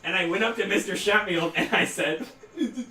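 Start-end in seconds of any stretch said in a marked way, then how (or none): 2.5-2.8 high, hammed-up tearful wail; blubbering complaint, played for laughs; mock crying. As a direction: imitate a sobbing kid telling on someone. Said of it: "I started to cry".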